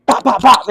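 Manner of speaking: three popping hit noises; punchy "pop pop pop" combination; imitated punches.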